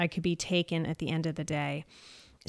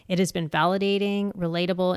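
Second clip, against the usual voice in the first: usual speaking voice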